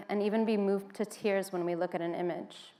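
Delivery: usual speaking voice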